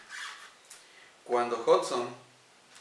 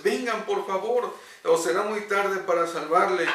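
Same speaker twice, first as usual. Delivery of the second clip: higher, louder pleading call; urgent shout; 5 semitones higher, 5 dB louder than usual. Acted. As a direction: keep shouting, running out of time. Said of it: "seguía gritándoles". (grito urgente de súplica)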